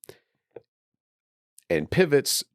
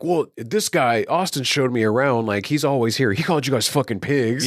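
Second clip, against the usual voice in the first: higher-pitched acted protest; defensive blaming; 7 semitones higher than usual. Craig blames Austin to the cops, defensive blaming. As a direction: imitate a man tattling to save himself.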